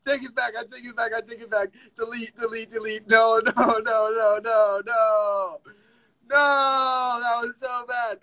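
high, pleading comic whine; embarrassed retraction. Real, not acted. Streamer taking back a bad accent attempt, mortified whine. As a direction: whine it high, repeating in a rush.

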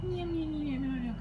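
sleepy kitten voice; cat meowing. Acted. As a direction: purr out sleepy cat meows. Sleeping cat assistant meows.

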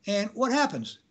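ordinary delivery

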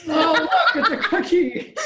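high, loud and laughing; mock excited. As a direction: gush over it like a treasure. Mock wonder at cookie.

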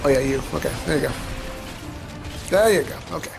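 normal talking voice

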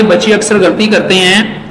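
ordinary talk, nothing marked

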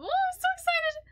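high-pitched excited squeal; real excitement. Real, not acted. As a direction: let excitement send your voice shooting up.

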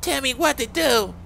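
put-on brave voice. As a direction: kid trying hard to sound brave. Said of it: "Try talking with a brave voice".

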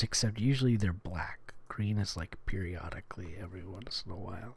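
fast hushed whisper. Real, not acted. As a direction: whisper it quickly. Whispered aside.

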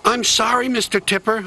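sorry and sheepish, pitched high; apologetic little engine. A narrator's reading. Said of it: "apologized Percy".

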